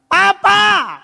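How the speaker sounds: very high, loud call; child's scared yell. Acted. Frightened child calling for father, acted yelling. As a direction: cry out for papa in mock panic. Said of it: "Dia cuma teriak".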